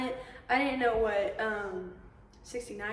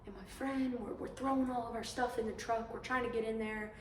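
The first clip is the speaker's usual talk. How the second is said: hushed retelling, low and soft; storyteller going quiet for suspense; 3 semitones lower, 13 dB quieter than usual. Retold or imitated. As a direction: drop to a near murmur. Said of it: "he goes quiet for a second".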